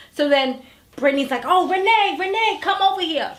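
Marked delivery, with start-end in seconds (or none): 1.5-3.3 calling out. Retold or imitated. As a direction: call her over, pitched up. Brittany calling Renee over.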